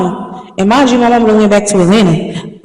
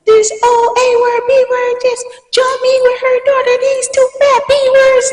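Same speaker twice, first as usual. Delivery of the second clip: cursing rant. Retold or imitated. Cursing them out to mom.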